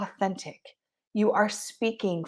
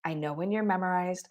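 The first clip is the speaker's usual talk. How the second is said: ordinary talk, nothing marked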